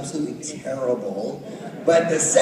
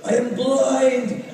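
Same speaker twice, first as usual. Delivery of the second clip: dramatic warrior line; 9 semitones higher, 5 dB louder, much slower than usual. Retold or imitated. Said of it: "and my, my line was".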